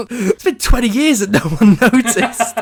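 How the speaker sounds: high, loud, speaking through real laughter; laughing hard. Real, not acted. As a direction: burst out laughing while talking. Cracking up over unnoticed sound.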